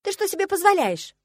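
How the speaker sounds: high, loud indignant demand. A narrator's reading. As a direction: demand it, outraged. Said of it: "возмутилась я". (возмущённо, высоко и громко)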